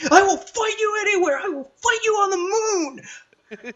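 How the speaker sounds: screamed way up high, cracking into laughter; hammed-up meltdown howl that breaks into a laugh; shrieking cartoonish threat, laughing through it; mock unhinged rage. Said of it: "so it's like".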